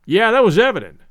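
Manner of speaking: firing up. A narrator's reading. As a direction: snap back, suddenly stung. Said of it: "firing up".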